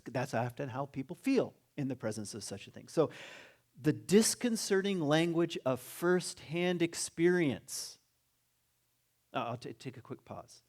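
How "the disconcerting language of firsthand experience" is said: very slow, higher, deliberate reading; drawn-out, emphatic recitation; reading aloud. Acted. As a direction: read it out with weight on each phrase. Reads a quoted passage aloud.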